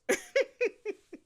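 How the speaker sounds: shrill giggling squeal; high laugh. Real, not acted.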